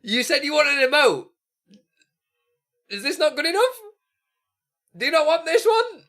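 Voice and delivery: high, mock-hurt questions; high mock-offended voice. Real, not acted. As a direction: play wounded about the emote in a high voice. Mocking emote, high voice.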